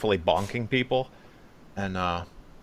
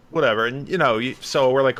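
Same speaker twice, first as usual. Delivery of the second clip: ordinary delivery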